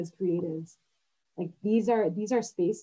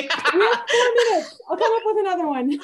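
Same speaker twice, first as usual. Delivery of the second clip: high laughing quip; 9 dB louder than usual. Real, not acted. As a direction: joke through laughter. Joking about time left.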